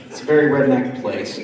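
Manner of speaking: ordinary delivery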